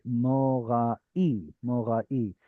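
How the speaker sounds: slow "morai" with each vowel drawn out in full; exaggerated vowels. Shown as a demonstration. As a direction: stretch every vowel of "morai", syllable by syllable. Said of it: "just exaggerate the vowels".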